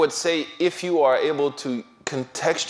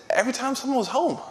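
mock excuse; 13 semitones higher, faster than usual. Student's retold excuse, acted quote.

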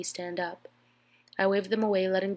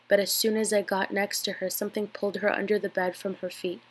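ordinary delivery

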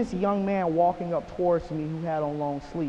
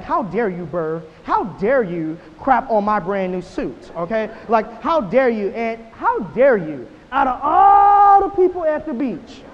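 loud, slow, indignant rant at the bird; hammed-up fury, building to a shout; mock outrage; 9 dB louder, slower than usual. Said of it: "I was mad".

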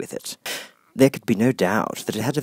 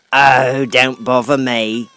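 put-on louder, slow snapping old duchess; 3 semitones higher, 10 dB louder, much slower than usual. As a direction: brush the girl off impatiently.

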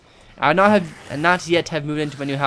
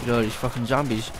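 ordinary talk

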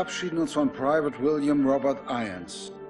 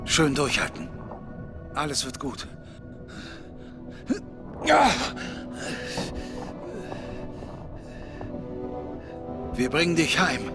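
reassuring; 10 semitones higher than usual. Acted. Comforting a wounded soldier.

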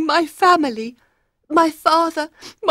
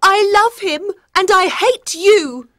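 very high, loud angry declaration; defiant queen; 7 dB louder than usual. Acted. Queen throws her love affair at husband, angrily. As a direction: hurl it at him in a high voice.

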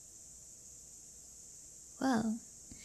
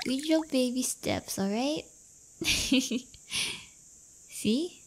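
normal talking voice

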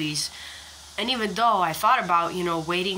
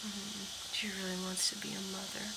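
breathy whisper, much softer; whispering near a nesting hen; 13 dB quieter than usual. Real, not acted. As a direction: hush so the hen stays calm.

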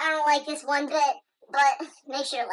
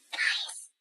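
whisper; 6 dB quieter than usual. Pretend. Scared whisper in spooky house.

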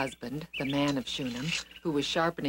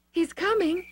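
put-on excited boy calling; 12 semitones higher than usual.

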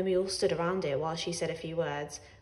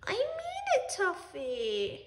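much higher, earnest insistence; mean every word, up high; Ellie insisting; 12 semitones higher, much slower than usual. A narrator's reading. Ellie telling Tuffy she means it, high earnest voice. Ellie tells cat firmly.

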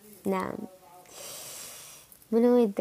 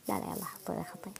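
whispered aside, conspiratorial; playful whisper; 4 semitones lower, 13 dB quieter, faster than usual. Real, not acted. Streamer whispering to viewers to mock a begging commenter. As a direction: whisper it so he won't hear.

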